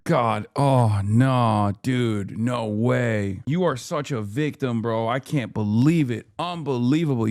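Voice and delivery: low, very slow fake sympathy; sarcastic mock pity. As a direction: drag it out, dripping with sarcasm.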